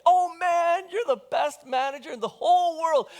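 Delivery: high, loud mock gushing praise; grateful debtor. Debtor thanks the manager for cutting his debt, loud mock delight. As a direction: gush over the manager like he saved you. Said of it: "And he's like".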